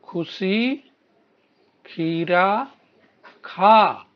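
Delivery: very slow, stretching each word; slow demo reading. Shown as a demonstration. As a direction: read it slowly so the children can copy. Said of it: "आगे बोलिए बच्चों". (शब्द खींचकर धीरे पढ़ना)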